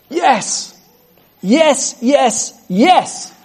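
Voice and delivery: high, thrilled cry of joy; excited yes. Real, not acted. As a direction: cheer it out, delighted by the promise. Preacher exults over scripture.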